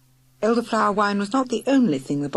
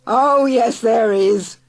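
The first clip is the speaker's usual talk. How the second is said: loud, higher retort; shouting back; 5 semitones higher, 8 dB louder, much slower than usual. Acted. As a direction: shout the contradiction. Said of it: "shouted Murgatroyd".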